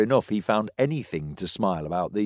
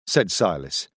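ordinary delivery, nothing marked

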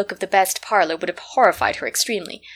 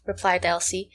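plain reading voice

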